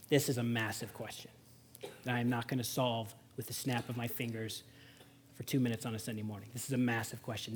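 low, hushed, weighty delivery; solemn preaching. Real, not acted. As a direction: drop low and soft for a grave question. Sober approach to hard question.

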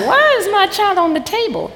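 high, startled question; baffled mom. Retold or imitated. Mother finding her son on the table, high and baffled. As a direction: ask it in mock disbelief. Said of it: "And I was like".

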